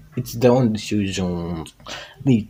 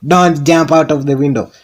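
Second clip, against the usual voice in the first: Don't said higher and louder as a warning; 6 semitones higher, 7 dB louder than usual. Shown as a demonstration. Warning listeners not to jump, louder. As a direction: repeat it firmly, raising the voice. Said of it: "Not unless you're in a tall building".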